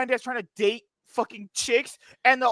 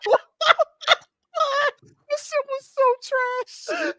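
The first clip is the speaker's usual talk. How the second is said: shocked. Retold or imitated. Recalling his reaction to the episode, high mock shock. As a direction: squeal What the fuck? in disbelief. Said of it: "I was like".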